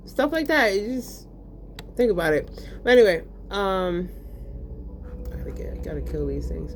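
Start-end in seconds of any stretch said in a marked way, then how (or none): 5.3-6.7 whispered gaming mutter; hushed focus while playing. Real, not acted. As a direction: whisper it to yourself mid-fight.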